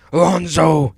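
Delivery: high, very loud villain summons; sudden shrieking call; screeched. A narrator's reading. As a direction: screech for your servant. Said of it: "he screeched".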